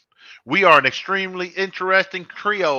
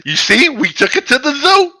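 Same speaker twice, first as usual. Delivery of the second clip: silly high voice; 13 semitones higher, 8 dB louder than usual. Pretend. Loud silly voice.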